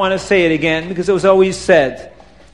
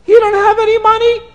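put-on much higher childish astonishment; children's disbelief; 11 semitones higher than usual. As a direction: cry out like shocked kids. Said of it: "the Kinalak say".

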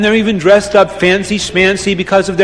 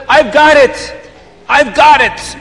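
excited; 6 semitones higher than usual. Acted. Reb Chaim's sudden excited discovery.

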